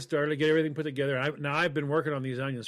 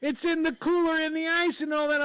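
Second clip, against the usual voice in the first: high-pitched, worked-up protest; comically indignant, high-pitched explaining; exasperated defence; 12 semitones higher than usual. Real, not acted. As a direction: plead your case in a high voice.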